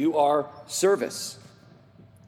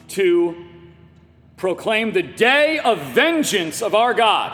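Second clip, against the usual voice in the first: loud, slow proclamation of judgment; thundering scripture; 2 semitones higher, 5 dB louder, slower than usual. Acted. Preacher declaims Messiah's vengeance verse to congregation, thundering. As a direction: boom it out with drawn-out force.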